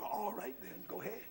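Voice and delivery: quiet giving-in. Retold or imitated. Lender gives in to the church men, quietly. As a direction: let it fall away almost under the voice.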